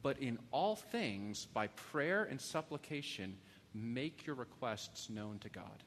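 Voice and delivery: slow, deliberate reading of the verse; slow scripture reading. Shown as a demonstration. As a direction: let each phrase land slowly.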